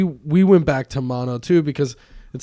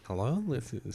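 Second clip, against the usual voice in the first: NPR radio voice; 4 semitones lower, 10 dB quieter than usual. Retold or imitated. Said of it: "an N P R effect on our voices".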